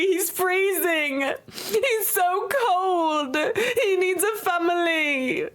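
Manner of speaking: hammed-up British accent, pleading for the cold pet; mock British sob story. British accent as a mock-sad tale to chat about finding the pet. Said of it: "speaking the Queen's tongue".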